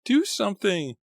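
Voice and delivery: high panicked shout; alarmed high-pitched plea; alarmed cry. A narrator's reading. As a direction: yell for help in alarm. Alarmed cries in living room.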